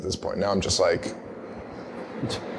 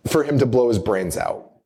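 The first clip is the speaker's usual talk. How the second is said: ordinary talk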